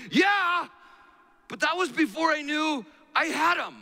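put-on high, loud child voice; little boy's retort. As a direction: imitate a small boy protesting loudly. Preacher voices his son's retort.

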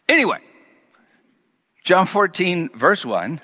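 ordinary talk

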